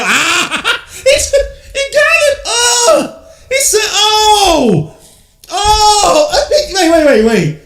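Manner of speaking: high, loud whooping disbelief; excited outburst. Real, not acted. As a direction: lose it over a huge play, half laughing. Fan reacting to a big play.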